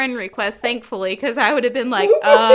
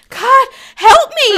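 high, loud, slow laughing cry for help; laughing plea; 10 semitones higher, 9 dB louder, slower than usual. Retold or imitated. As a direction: beg for rescue through laughter. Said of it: "I'm looking at the other two people and I'm like".

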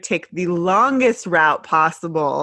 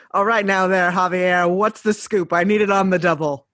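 a light hint of Kramer bursting in; Kramer-style entrance. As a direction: barge in like Kramer, brash and quick. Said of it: "Kramer from Seinfeld style".

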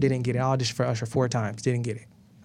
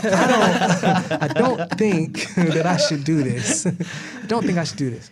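laughing through it; 3 semitones higher, 3 dB louder, slower than usual. Real, not acted. Laughing recalling his fear.

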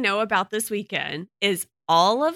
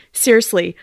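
ordinary talk

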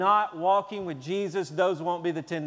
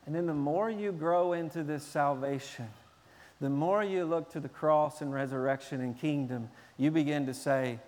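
ordinary talk

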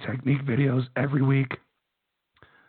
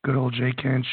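raspy, hoarse voice; losing his voice, rough and raspy; slower than usual. Real, not acted. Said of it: "sounds absolutely terrible".

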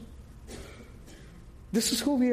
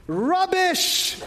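loud, high British "Rubbish!" with a crisp British R; 9 semitones higher, 3 dB louder than usual. Shown as a demonstration. Preacher shows the congregation how the British say it, loud British. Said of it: "That's how British would say it".

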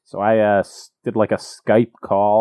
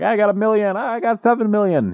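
put-on high-pitched kid boasting; mock bragging schoolkids; 10 semitones higher than usual. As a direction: pitch it way up, playground one-upping. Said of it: "everybody's at school and they're like".

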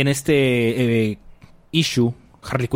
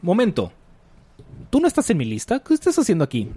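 higher, startled questioning; sudden double-take; surprised; 6 semitones higher, faster than usual. Retold or imitated. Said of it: "Y, este".

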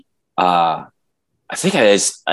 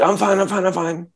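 put-on 1 drunk insisting; 8 semitones higher than usual. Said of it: "he's like".

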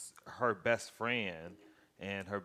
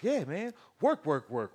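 dazed coworker; 7 semitones higher, 6 dB louder than usual. Retold or imitated. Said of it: "the dude was just like".

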